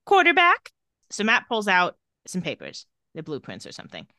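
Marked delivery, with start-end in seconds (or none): 0.1-0.7 loud, high summons; acted-out commanding call. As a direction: call on him sharply, pitched well up. Voicing Damon calling on Matt.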